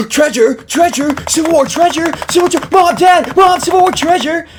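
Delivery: put-on very high, loud childlike shrieking; excited kids yelling. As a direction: shriek like kids clamouring for their parents. Kids excited about treasure in story.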